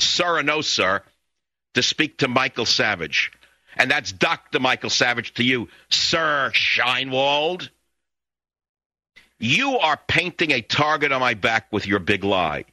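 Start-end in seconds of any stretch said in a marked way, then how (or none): 5.9-7.7 slow, drawn-out sneer at a posh English title; mock posh English. Talk host mocking the British ambassador's title in slow mock posh English. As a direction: stretch it out like a snooty English lord. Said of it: "what kind of snotty accent you put on".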